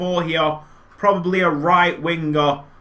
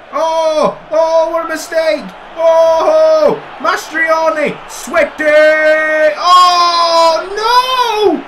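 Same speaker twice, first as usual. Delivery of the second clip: high, loud cries building to a howl on no; rising anguished yells building to a cry of no; anguished shouting; 8 semitones higher, 6 dB louder, much slower than usual. Real, not acted. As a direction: watch the disaster unfold and howl. Conceding from a keeper mistake.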